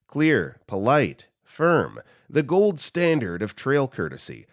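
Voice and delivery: usual speaking voice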